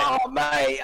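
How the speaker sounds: high mock Aussie "mate"; Australian accent. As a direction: drawl out a jokey Aussie mate. Mocking Australians.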